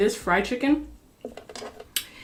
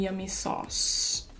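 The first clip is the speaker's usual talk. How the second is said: whispered; 5 dB quieter, much slower than usual. Real, not acted. Savoring the sauce.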